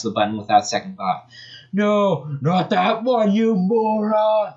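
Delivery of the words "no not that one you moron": high, far mock shout, stretched slow; villain shouting at Sonic, high and drawn out. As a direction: Eggman yelling in a high put-on voice. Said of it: "shouted Eggman".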